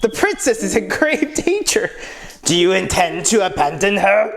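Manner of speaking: high-pitched cartoon Wario voice; Wario accent. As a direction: do Steiner as an over-the-top Wario.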